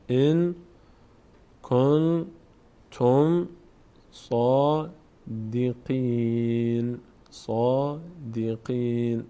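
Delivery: slow word-by-word tajweed; drawn-out nasals and long vowels; Quran recitation. Shown as a demonstration. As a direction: teach by reciting each word slowly.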